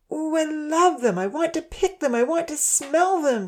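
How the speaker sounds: put-on child's delighted gushing; called out. A narrator's reading. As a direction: gush over the flowers like a small girl. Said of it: "she called out".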